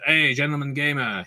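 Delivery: a hint of a French drunk hailing across the bar; mock French heckle from the bar; French accent. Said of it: "as you can tell from the accent".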